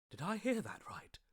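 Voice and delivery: whisper. Acted. Stunned at news of her.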